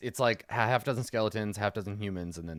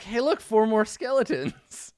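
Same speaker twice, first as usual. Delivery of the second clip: higher, drawn-out henchman voice; henchmen to each other; 8 semitones higher, 3 dB louder than usual. Acted. His men looking at each other, sizing up newcomers, high henchman voice. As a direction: pitch it up and stretch it out. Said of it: "his men look at each other like".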